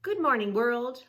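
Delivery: put-on bright, high and loud girl's voice; cheerful Rachel. Rachel greets the sun, bright high girl's voice.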